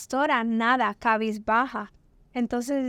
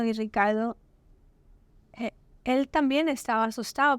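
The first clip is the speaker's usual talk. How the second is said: ordinary talk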